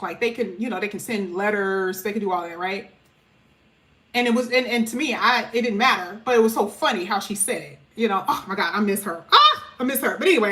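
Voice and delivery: ordinary talk, nothing marked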